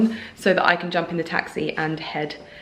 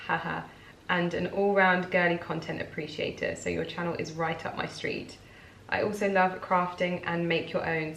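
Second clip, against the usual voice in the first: reading letter aloud; 6 dB quieter than usual. Real, not acted. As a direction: read a fan's note quietly and evenly. Reads a fan letter aloud.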